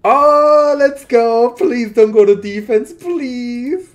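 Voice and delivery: loud, high cheer sliding into a pleading cry; excited shout. Real, not acted. Player cheers a roll and begs it to avoid defense, loud high excited cry. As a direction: yell with glee, then beg.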